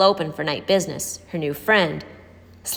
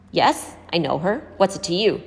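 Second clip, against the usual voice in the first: usual speaking voice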